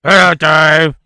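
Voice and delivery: talking through a gum shield; loud, muffled by a mouthguard; wearing a mouthguard. Real, not acted. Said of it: "please talk about it without the gum shielding".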